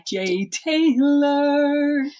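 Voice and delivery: high drawn-out name call; announcer voice. Acted. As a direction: stretch out your own name like a game show. Host announcing own name.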